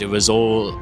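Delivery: ordinary talk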